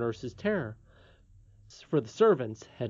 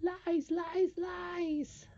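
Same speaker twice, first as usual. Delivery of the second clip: high, thin goblin taunt; hoarse indistinct voice; 5 dB quieter, much slower than usual. A narrator's reading. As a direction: taunt from the shadows in an eerie high voice. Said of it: "a hoarse, dis-, indistinct voice".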